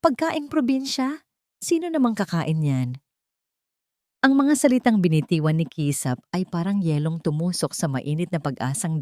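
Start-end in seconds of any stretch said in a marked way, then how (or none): 0.0-3.0 mocking sneer. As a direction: look down on the food as you say it. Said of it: "tumawa si Kisap nang may pang-uuyam". (mapang-uyam na tanong)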